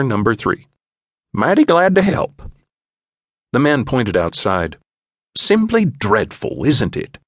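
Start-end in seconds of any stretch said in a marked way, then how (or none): 1.3-2.4 higher, folksy eager postman; chirpy small-town helper voice. A narrator's reading. Norbert offers help to the stranger, higher eager folksy voice. 5.4-7.2 higher, slow, put-upon English gentleman; drawn-out fussy English complaint; English stranger. A narrator's reading. Stranger complains about the weather, higher slow English voice.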